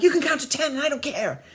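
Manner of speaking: high, loud bratty protest; mock child tantrum. Child defying mother from bathroom, mock tantrum. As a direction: play a kid shouting his refusal.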